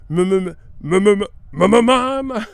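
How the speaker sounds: high, loud repeated "m" bursts, building; stutter like engine revving. Retold or imitated. Showing how the commentator sounded, engine-revving stammer. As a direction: rev up on one consonant and never get there. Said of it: "It sounded like an engine revving".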